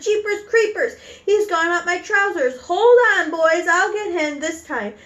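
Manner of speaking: high-pitched hollering cook; shouted. Acted. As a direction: holler to the other cooks in alarm. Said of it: "the cook shouted".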